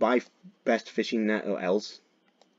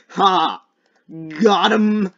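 high, loud victory cry; triumphant; 8 semitones higher, 9 dB louder, much slower than usual. Real, not acted. Player who just got the target, triumphant cry. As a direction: crow loudly over the catch.

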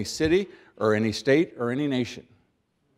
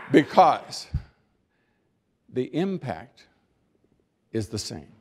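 long dramatic pauses before "the impact" and before "is the same"; pause for emphasis; slower than usual. Shown as a demonstration. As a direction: hold the silence, then land "the impact is the same" slowly. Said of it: "I am now going to pause for emphasis".

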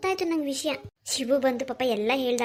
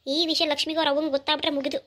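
ordinary talk, nothing marked

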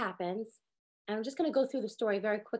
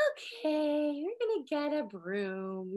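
put-on slow, composed calm instruction to the kid; 4 dB quieter, much slower than usual. Mom calmly telling son to get a broom, patient. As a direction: keep it steady and patient. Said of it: "in that moment, like, to just be like".

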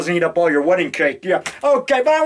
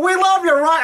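imitating drunk fan; 9 semitones higher than usual. Prank caller declaring love at 2am, mock gushing. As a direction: ham up a drunk fan's love call. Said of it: "They call me up at two thirty in the morning saying".

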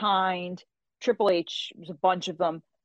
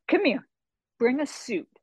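brisk summons; 7 semitones higher than usual. Retold or imitated. Retold phone summons.

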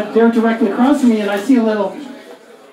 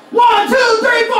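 much higher, shouted count-in; 12 semitones higher than usual. Pretend. Comic counting in his song, shouted. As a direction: yell the band in like a rock show. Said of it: "I can still sing".

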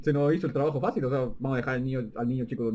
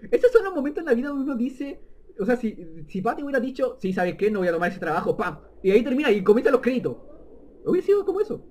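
excited rant; 8 semitones higher, 5 dB louder than usual. Real, not acted. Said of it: "excited commentary on plot".

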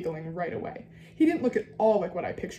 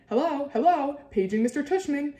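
high, louder mock old-lady voice; fake old lady; 7 semitones higher, 4 dB louder than usual. A narrator's reading. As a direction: put on a high old lady making an announcement. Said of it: "using a fake high old lady voice".

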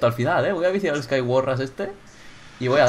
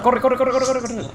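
high, rapid-fire urging; urgent urging; 11 semitones higher, 3 dB louder, slower than usual. Real, not acted. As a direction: hurry your teammate along, high and breakneck. Urges teammate to run.

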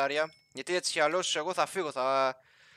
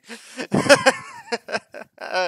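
high, loud cackling laughter; helpless laugh; 13 semitones higher, 12 dB louder than usual. Real, not acted. Laughs at own word slip.

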